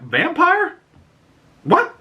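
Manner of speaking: very high incredulous squeal; shocked disbelief. Real, not acted.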